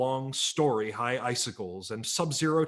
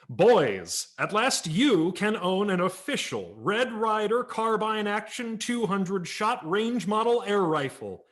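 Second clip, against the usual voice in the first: a touch higher, cowboy pitchman calling out; Red Ryder voice; 6 semitones higher than usual. Acted. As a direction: sell it like a hearty cowboy hero.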